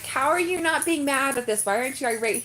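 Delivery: indignant mock yell at husband; retold angry outburst. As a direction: replay the furious question to husband. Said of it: "I was so mad".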